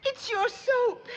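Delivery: high, slow, crying complaint; sobbing high and drawn out; tearful wail. Acted. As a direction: blubber it out through tears. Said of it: "tell old Granny what's got you to bawling".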